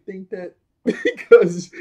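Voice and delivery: loud words through laughter; laughing while talking. Retold or imitated. Odd teasing Jeremy, loud and laughing. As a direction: laugh the line out. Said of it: "He said".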